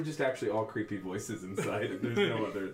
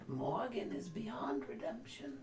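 creepy whisper; 13 semitones higher, 7 dB quieter, much slower than usual. Acted. Oracle answers in whisper.

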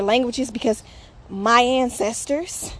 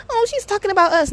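higher, louder mock whine of an offended person; 10 semitones higher, 4 dB louder than usual. Mimicking a person who thinks she is talking about them, mock squeaky. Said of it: "a person will be like".

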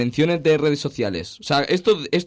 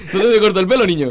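laughing quote; 5 semitones higher, 7 dB louder than usual. Retold or imitated.

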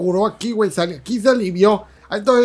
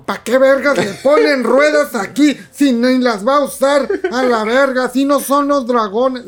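mock rant; 6 semitones higher, 4 dB louder, slower than usual. Imitating an annoyed viewer.

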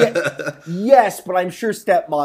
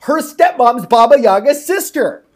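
high, loud plot-twist reveal; loud, thrilled outburst; excited exclamation; 7 semitones higher, 8 dB louder than usual. Real, not acted. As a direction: shout the big reveal.

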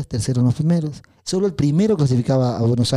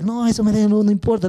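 put-on boyish protest; 8 semitones higher than usual. Teacher voices boy dismissing medal.

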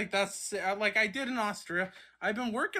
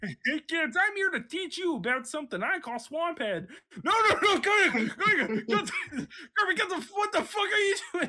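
Kermit voice; 9 semitones higher than usual. Pretend. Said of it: "Kermit bit continues".

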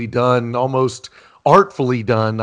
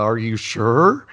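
slow, drawn-out doubtful ask; lightly voiced skeptical girl; a hint of teasing doubt; Lisa's doubtful question; slower than usual. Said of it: "I love when Lisa says".

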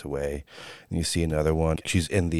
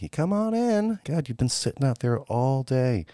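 much higher, lilting voice calling him in; a friendly woman calling from the porch; sweet Southern woman calling; 9 semitones higher than usual. Acted. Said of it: "She's like".

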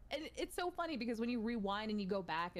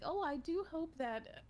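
put-on prim old Hollywood lilt; glamorous star accent, a little off; Mid-Atlantic accent; 7 semitones higher than usual. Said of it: "You were getting there".

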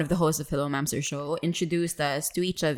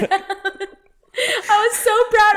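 much higher, louder, very slow laugh; laughing, proud; 6 dB louder, much slower than usual. Real, not acted. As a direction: stretch it out laughing with pride. Recalling her proud hand raise.

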